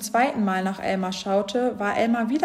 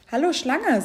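higher, sing-song hello; calling a greeting; 6 semitones higher, 3 dB louder than usual. A narrator's reading. Elephants greeting the snake, calling out.

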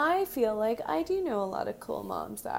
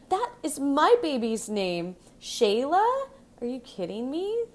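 outraged, higher and louder on Shayla; sweet to demon snap; 5 semitones higher, 4 dB louder than usual. Retold or imitated. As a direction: flip from sweet to furious demon. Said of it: "instantly turns from sweet to demon".

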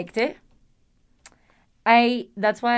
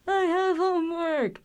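her friend's excuse in a high voice, much higher; mimicking her friend; 9 semitones higher than usual. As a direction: squeak it out in a high voice, imitating the friend. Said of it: "she's like".

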